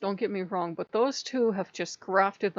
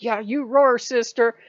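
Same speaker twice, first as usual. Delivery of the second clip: loud, higher cheer of support; cheering her on; 3 semitones higher, 9 dB louder than usual. Retold or imitated. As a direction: shout encouragement like a fan in the crowd. Cheering on the comedian.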